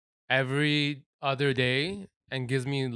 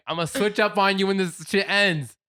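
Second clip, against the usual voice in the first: mock threat, higher and louder; joking threat; 6 semitones higher, 5 dB louder than usual. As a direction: playfully warn them with raised voice. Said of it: "I'm joking".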